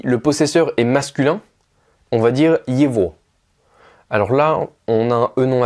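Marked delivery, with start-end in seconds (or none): 2.7-3.1 yevo with a v for the written g; yevo, written g sounded as v. Shown as a demonstration. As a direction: say yevo with v between the vowels. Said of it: "un guet entre deux voyelles va se prononcer V".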